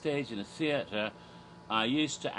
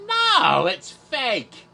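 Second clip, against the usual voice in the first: loud, high mock outburst; exclaimed denial; 9 semitones higher, 11 dB louder, much slower than usual. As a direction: burst out with a comic confession. Retold reply to the lady's question.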